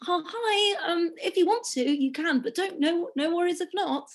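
high, timid little request; apologetic, indirect. Retold or imitated. Guest acting out her own tweets asking for money, apologetic. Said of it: "just be more direct".

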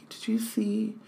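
hushed gossipy whisper. Pretend. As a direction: lean in and whisper it. Gossiping officers imagined.

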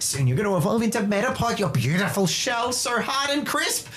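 high, fast, adoring praise; gushing kid trainer. Acted. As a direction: dream aloud about your Pokemon evolving. Kid gushing over his Caterpie.

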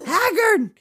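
high, loud cry for a king; hammed-up screamed name; shouting. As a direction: shout the name like raising the alarm. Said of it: "starts shouting for King Haggard".

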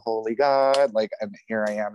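usual speaking voice

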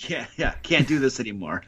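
soft, low gravel; gravelly voice. Pretend. Guest echoing the host's sore-throat voice, low gravelly rasp. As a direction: play along in a quiet, low rasp.

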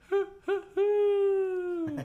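very high, loud hoots; imitated human hooting. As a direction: hoot like a person making owl calls. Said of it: "it sounded like a person going".

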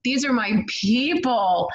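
elated, a little in awe; joyful realization. Retold or imitated. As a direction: say it like you finally found home. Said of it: "And I was like".

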